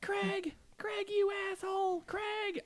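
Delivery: very high, strained scream without full volume; mock screaming. Imitating brother raging on the game all night, mock screaming. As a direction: imitate a gamer screeching at another player. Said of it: "He was just always screaming".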